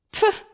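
souffle moqueur très aigu; pfff méprisant. Acted. Frère dégoûté par la boue. (le frère souffle son dégoût devant la boue)

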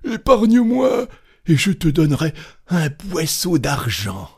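high, loud begging that eases into an offer; desperate cry. A narrator's reading. Cantor bargaining from inside the chest, desperate high plea. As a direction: plead for your life. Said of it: "cria le chantre".

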